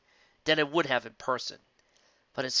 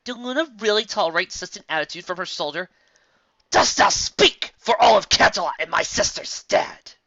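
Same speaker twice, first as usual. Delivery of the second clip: angry indignant; 8 semitones higher, 10 dB louder than usual. Acted. Celestia speaks, angry.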